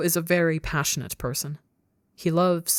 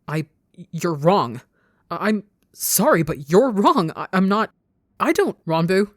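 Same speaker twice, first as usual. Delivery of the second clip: louder, stammering frantic denial; 2 semitones higher, 6 dB louder than usual. A narrator's reading. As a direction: keep stumbling on, desperate to deny it. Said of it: "Frantically keeps going".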